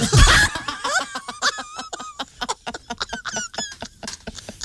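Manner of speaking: high shrieking burst of laughter. Real, not acted. Big laugh after the joke.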